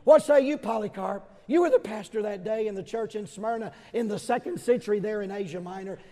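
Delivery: usual speaking voice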